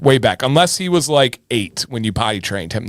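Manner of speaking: ordinary delivery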